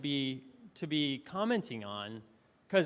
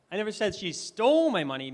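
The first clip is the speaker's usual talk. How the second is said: "stole" stretched, loud and high; stress on STOLE; 4 semitones higher, 6 dB louder than usual. Shown as a demonstration. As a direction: lean hard on the word "stole". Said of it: "I emphasized different words".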